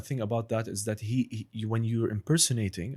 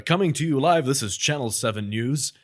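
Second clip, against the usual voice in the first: news anchor; 3 semitones higher, 4 dB louder than usual. Pretend. Asked by a friend to do a news anchor voice. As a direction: announce it like a TV newsreader. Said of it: "let's hear news anchor".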